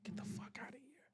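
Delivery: barely voiced, stunned whisper; hushed disbelief. Acting out his disbelief.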